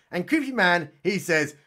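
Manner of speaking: normal talking voice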